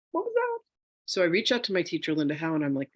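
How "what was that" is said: very high startled squeak; retold fright. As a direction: act out being spooked, voice shooting way up. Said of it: "I'm scared out of my mind".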